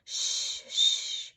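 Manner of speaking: put-on gentle shh to calm a baby; soothing shush. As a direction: hush a crying child. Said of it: "rocked and sang to him".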